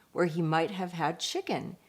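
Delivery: plain reading voice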